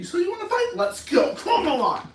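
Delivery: loud, high goading; acted belligerent dare; combative challenge. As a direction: square up for a fight. Acts picking a fight.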